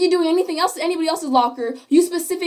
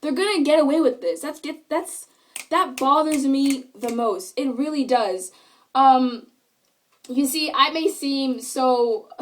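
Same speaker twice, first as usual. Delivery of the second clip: ordinary delivery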